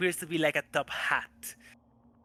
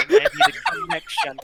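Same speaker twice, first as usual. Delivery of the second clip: higher and louder, laughing through it; laughing excuse; 9 dB louder than usual. Real, not acted. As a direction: giggle while blaming the phone.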